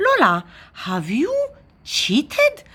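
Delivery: halting question leaping high at the end; shocked accusation. A narrator's reading. Brother finds the spoon has moved, shocked. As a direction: accuse her in rising disbelief.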